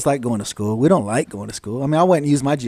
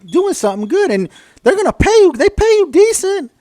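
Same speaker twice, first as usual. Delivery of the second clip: louder, much higher, pushing them hard; rally them to go get a degree; fired-up advice; 7 semitones higher, 4 dB louder than usual. Retold or imitated. Veteran urging people getting out, loud and high. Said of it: "It's like".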